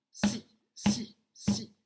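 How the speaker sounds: "si" repeated as short, separate beats; isolated first syllable. Shown as a demonstration. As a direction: punch out "si" with a gap after each. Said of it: "Donc la première syllabe".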